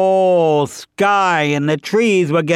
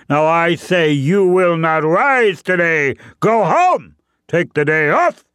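lightly voiced boastful bear, higher and quick; mildly indignant bear giving orders; bossy bear; 5 semitones higher, faster than usual. Bear commands the sun a second time, bossy and insistent.

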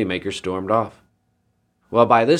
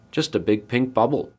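usual speaking voice

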